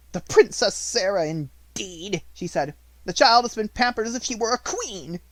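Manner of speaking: mock the title with contempt; sneering. A narrator's reading. Headmistress sneering to herself about the pupil.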